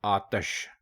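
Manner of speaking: आतश with a short a after the t, aa-tash; correct pronunciation. Shown as a demonstration. Teacher repeating the correct form: आतश as aa-tash. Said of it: "इसी तरह इसको बोलेंगे".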